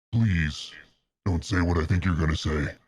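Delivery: ordinary delivery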